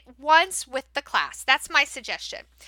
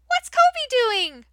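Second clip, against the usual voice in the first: much higher, slow, sing-song amazement; animated wonder; 8 semitones higher, slower than usual. Shown as a demonstration. Teacher asking young learners about the animation, amazed. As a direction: make it a big exciting mystery.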